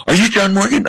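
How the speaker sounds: high-pitched voice of an admiring lady; imitated eager fan. Lady running up to author on street, imitated eagerness. Acting out lady who ran up.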